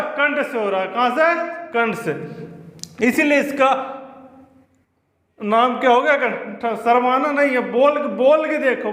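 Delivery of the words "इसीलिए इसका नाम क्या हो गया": usual speaking voice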